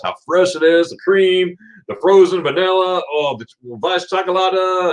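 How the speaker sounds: high-pitched dessert rapture; acted, swooning enthusiasm; rapturous raving. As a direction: rave lovingly over every ingredient. Acts out raving about favorite dessert.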